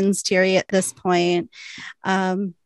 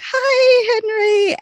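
much higher, strangled squeak; squeaky voice; 6 dB louder, much slower than usual. Retold or imitated. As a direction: squeak the greeting like a flustered sister. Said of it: "squeaky voice".

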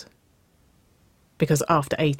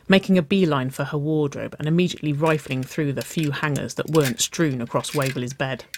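usual speaking voice

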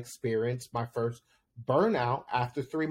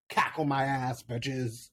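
mock sassy comeback; slower than usual. Answering the ladies who called her a hag, mock sass. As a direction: talk back like a diva. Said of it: "a cackle to remember".

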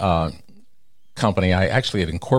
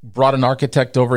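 ordinary talk, nothing marked

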